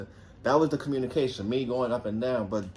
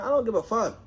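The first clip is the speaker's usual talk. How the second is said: much higher dismissive shout; defiant retort; 10 semitones higher than usual. Retold or imitated. Telling girlfriend he doesn't care, defiant and high. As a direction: toss it off defiantly.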